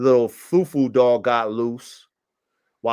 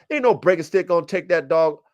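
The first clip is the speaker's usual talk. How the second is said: ordinary delivery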